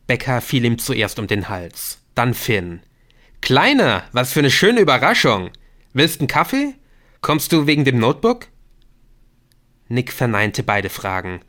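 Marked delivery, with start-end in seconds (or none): 3.4-8.5 delighted greeting. Acted. Becca greets Nick warmly.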